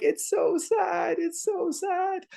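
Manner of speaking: put-on very high, slow child's sob-voice; crying child voice. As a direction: whimper like a small child. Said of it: "And they're like".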